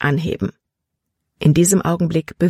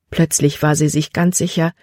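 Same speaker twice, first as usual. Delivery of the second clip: ordinary delivery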